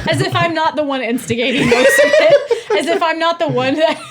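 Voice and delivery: high, loud protest through laughter; laughing indignation. Real, not acted. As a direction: protest incredulously while cracking up. Laughing mock protest at friend.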